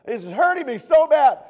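high, loud angry outburst; hammed-up kid raging at dad; mock angry kid. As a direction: vent it like a furious child. Child venting to dad.

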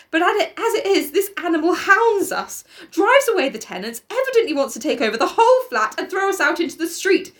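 high, urgent, insistent plea; sister crying out. A narrator's reading. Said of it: "cried the sister again".

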